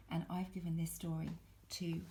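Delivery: plain reading voice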